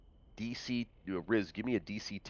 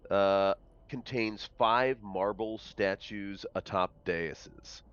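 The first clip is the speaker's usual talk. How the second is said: louder, measured read-aloud narration; game master's scene setting; 8 dB louder than usual. A narrator's reading. Game master describes a rubble strewn vault to players, projected narration. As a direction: announce the room to the players.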